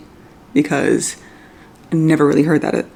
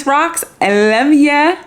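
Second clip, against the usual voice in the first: high, slow loving call-out; drawn-out fond shout-out; affectionate shout-out; 10 semitones higher, slower than usual. Real, not acted. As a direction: sing it out to a friend. Calling out to friend on camera.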